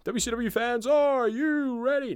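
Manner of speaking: booming, stretched-out announcer call; ring announcer. Retold or imitated. Imitating ring announcer intro.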